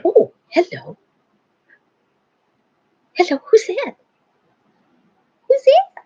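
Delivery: high-pitched, teasing call to a dog; gasping, high mock surprise for a pet; excited pet talk. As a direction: get the dog worked up, pitch way up.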